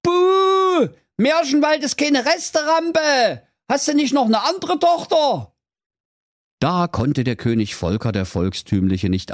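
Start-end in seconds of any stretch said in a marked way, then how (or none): 0.0-5.5 high squeaky booing folk; unhappy crowd heckling the king; grumbling crowd. A narrator's reading. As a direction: boo and complain like a disgruntled crowd. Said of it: "das Volk murrte und knurrte und rief".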